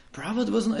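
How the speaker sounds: usual speaking voice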